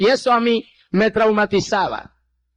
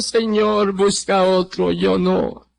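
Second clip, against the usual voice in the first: subdued plea, sinking lower; retold tearful plea; 5 semitones lower, slower than usual. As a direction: retell a weeping refusal with restraint. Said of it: "yo le dije llorando". (súplica llorosa contenida)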